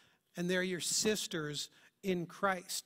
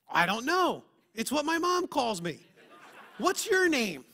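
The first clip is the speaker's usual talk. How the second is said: put-on high, loud little boy's voice; chatty kid; 4 semitones higher, 6 dB louder than usual. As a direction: play a cheerful five-year-old. Kid answering in story.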